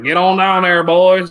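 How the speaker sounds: loud, high hammed-up American country drawl; country American accent. Joking about favorite accents, loud American country accent. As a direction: holler it like a cowboy in a thick American country accent. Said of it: "type accent".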